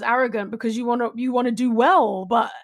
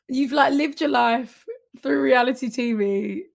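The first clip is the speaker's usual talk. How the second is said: usual speaking voice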